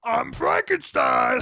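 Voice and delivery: dopey monster, drawn out slowly; slow Frankenstein voice. Retold or imitated. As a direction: announce yourself as a dim monster. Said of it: "That's how he talked in the fucking movie".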